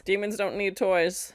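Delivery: normal talking voice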